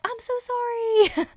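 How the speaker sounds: high, drawn-out mock apology; pleading apology. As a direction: squeak out a long, high sorry. Imagined driver apologizing in their car.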